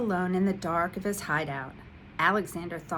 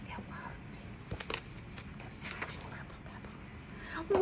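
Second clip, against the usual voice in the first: softer, whispered wonder; awed whisper; 12 semitones higher, 9 dB quieter than usual. A narrator's reading. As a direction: breathe it out in disbelief. Amazed the magic worked.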